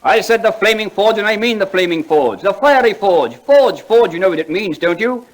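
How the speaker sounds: high, loud indignant insistence; indignant outburst. Acted. Dwyer insisting on the flaming forge to Clapham, indignantly loud. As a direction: shout him down in outrage.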